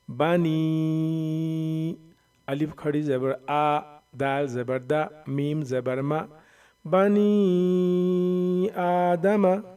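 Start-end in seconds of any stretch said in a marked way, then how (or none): 0.1-2.0 slow, drawn-out chanted recitation; Quran recitation. Shown as a demonstration. Teacher reciting the finished word for students, slow chant. 6.8-9.6 slow, drawn-out chanted recitation; Quran recitation. Shown as a demonstration. Teacher reciting the finished word for students, slow chant.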